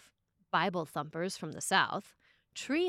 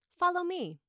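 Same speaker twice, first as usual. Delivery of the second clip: Jesus calling; 10 semitones higher, 5 dB louder than usual. Retold or imitated.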